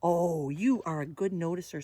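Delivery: ordinary delivery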